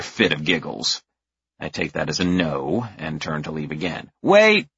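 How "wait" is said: high loud call; urgent kid call. A narrator's reading. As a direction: stop him like eager kids. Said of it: "they both say".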